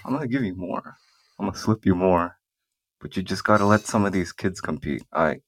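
ordinary talk

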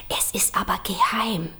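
whispered secret to the crowd. Acted. Poet confiding a secret to the audience, whispered. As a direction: lean in and whisper it. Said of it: "ich will euch mal was verraten".

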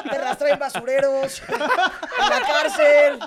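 giggling through the words, stretched out slowly; laughing. Real, not acted. Joking with friends about GPS patches, laughing high and slow.

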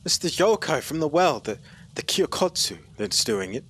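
high, loud and stammering; frightened pleading. Acted. Boy blaming the yokai, panicked, high and loud. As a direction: blurt it out in panic.

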